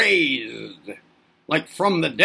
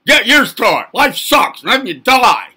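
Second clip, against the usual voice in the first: tough-love parent; 4 semitones higher, 9 dB louder than usual. Retold or imitated. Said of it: "You're like".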